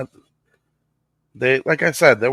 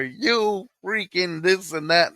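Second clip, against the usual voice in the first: slowed mock rant; 8 semitones higher, slower than usual. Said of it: "And then he's like".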